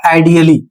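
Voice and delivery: "आइडियली" as ai-di-a-lee, the A lightly sounded. Shown as a demonstration. Teacher showing an exception, light A pronunciation. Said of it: "बहुत हल्का करना है".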